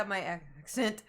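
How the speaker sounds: "accent" as ak-SENT, broken after "ac-", second syllable stressed; joke mispronunciation. Shown as a demonstration. Joking they'll start saying it so, "accent" as ak-SENT. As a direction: stumble on "ac-", then punch "cent" in "accent", rising. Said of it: "I'm just gonna start saying".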